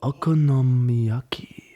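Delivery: put-on slow, careful Japanese accent on Okonomiyaki. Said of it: "do that accent".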